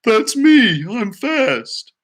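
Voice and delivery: pony butting into the song history; excited pony. Acted. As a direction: pop in proudly like a pony. Pony interrupts again.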